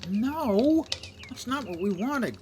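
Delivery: high, loud angry yell; frustrated outburst. Real, not acted. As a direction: shout in frustration. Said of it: "I make grunting, inarticulate, unhappy sounds".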